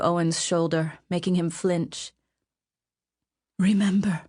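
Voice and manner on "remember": ordinary delivery, nothing marked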